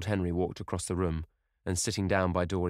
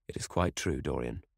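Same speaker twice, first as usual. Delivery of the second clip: grave confirmation, falling low on the name; 6 semitones lower than usual. A narrator's reading. Lord Henry confirms the death to Dorian, gravely. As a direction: confirm it soberly, without raising the voice. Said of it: "said Lord Henry gravely".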